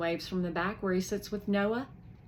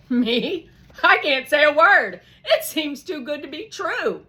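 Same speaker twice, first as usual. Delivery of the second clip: much higher and louder, amazed; overwhelmed excitement; 9 semitones higher, 12 dB louder than usual. Acted. Karina staring at the box of cash, amazed and loud. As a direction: burst out in disbelief.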